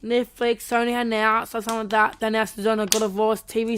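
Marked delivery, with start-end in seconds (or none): none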